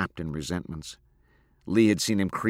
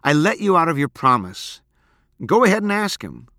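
loud, high, exasperated outburst; crying out; 9 semitones higher, 7 dB louder than usual. A narrator's reading. Girl releasing boyfriend from his promise, crying out. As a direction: fling it at him, climbing higher. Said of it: "she cried".

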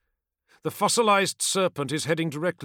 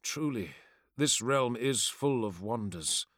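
usual speaking voice

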